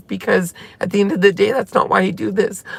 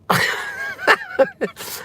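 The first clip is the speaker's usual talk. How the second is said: teary laugh; 4 dB quieter than usual. Real, not acted. Laughing through tears on stream.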